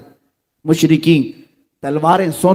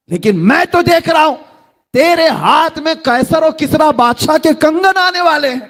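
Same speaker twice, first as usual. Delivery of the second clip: exalted prophecy; 10 semitones higher than usual. Acted. Prophecy of the kings' bracelets.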